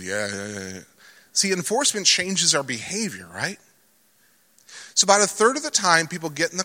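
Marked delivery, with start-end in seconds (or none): 0.0-0.8 low, soft grudging mumble; a quick run of low, soft yeahs; sheepish mumble. Retold or imitated. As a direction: mumble it like a teen caught out. Son's sheepish reply retold.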